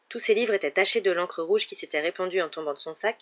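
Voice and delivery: plain reading voice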